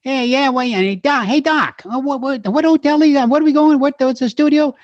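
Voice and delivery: fast-talking Brooklyn cabbie, pushy and friendly; Brooklyn cab driver. Retold or imitated. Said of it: "he kept saying".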